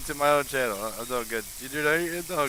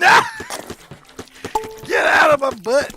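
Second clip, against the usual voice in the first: loud, high, hysterical protest; laughing yell; 8 dB louder than usual. Real, not acted. Player yelling at the game to get out, laughing shriek.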